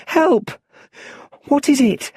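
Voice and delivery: high, loud, scared call; frightened cry. A narrator's reading. Little Bruce alone in the well, high, loud, frightened. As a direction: cry out at something unseen in the dark. Boy hears strange sounds in well.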